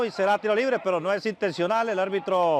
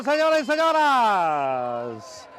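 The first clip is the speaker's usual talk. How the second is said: drawn-out announcer call; much slower than usual. Acted. Drawn out after goal call.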